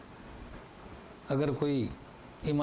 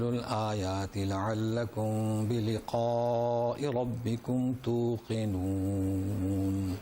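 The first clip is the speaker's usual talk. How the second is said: slow, melodic chanted recitation of Arabic; slow reverent Quranic chant; 3 semitones lower, much slower than usual. Acted. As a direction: draw each word out in measured tilawat.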